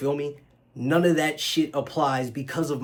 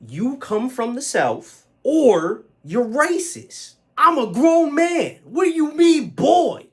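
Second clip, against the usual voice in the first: high, loud, drawn-out outrage; outraged yell with stretched-out words; indignant shouting; 12 semitones higher, 10 dB louder, much slower than usual. Acted. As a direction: shout it slowly and incredulously, way up high. Indignant outburst at a racist remark.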